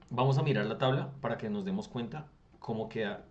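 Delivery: usual speaking voice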